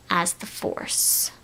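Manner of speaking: ordinary talk, nothing marked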